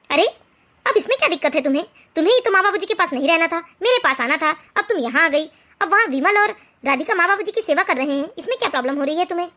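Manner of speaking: husband calmly talking his wife round. Acted. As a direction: patiently reason with a complaining wife. Husband loses patience with wife.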